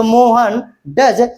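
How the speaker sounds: slow dictation. Shown as a demonstration. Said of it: "slow dictation while writing".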